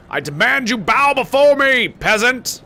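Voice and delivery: high, loud, slow mock tyrant; dark lord. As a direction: demand a bow like an evil overlord. Lording over a guard.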